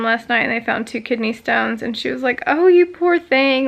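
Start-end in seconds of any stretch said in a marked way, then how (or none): 2.4-3.7 high, slow, sympathetic "oh, you poor thing"; imitating a sweet nurse, louder and higher; sympathetic coo. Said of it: "she was really sweet".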